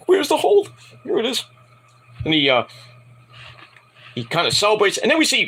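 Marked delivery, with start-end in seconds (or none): 0.1-1.4 high, squeaky imitation of a jittery climber; mock nervous. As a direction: tease someone's nerves in a falsetto. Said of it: "'cause he got nervous. I'm like".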